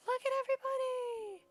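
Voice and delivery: very high, thin squeak of delight; delighted squeal. Real, not acted. Player marveling at new pictures, high delighted squeal. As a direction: squeak it out in wonder.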